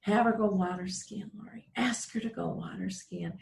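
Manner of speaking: imitating dad's hushed whisper in the ear. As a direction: whisper it like a secret instruction. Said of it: "hear my dad whisper in my ear".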